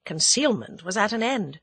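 plain reading voice

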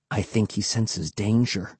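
hushed, wary undertone; softly spoken. A narrator's reading. Boy to friend as dog growls, softly. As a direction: say it softly, on guard. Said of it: "Will said softly".

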